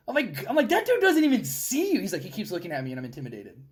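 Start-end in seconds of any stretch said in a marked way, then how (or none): 0.7-2.0 high, loud incredulous voice; can't believe what he's hearing; disbelieving. Retold or imitated. Mocking disbelief at the private. 2.2-3.6 soft, meek imitation of a scared kid; lightly voiced whiny private; mock timid private.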